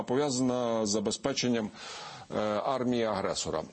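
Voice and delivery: ordinary delivery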